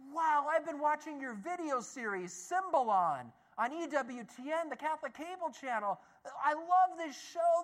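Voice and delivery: high, fast-gushing admirer, stretched "love"; gushing fan. Retold or imitated. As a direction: bubble over like a starstruck fan.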